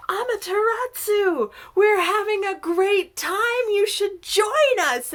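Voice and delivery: high, loud party call; calling out excitedly. Acted. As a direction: holler an invitation to someone shut away.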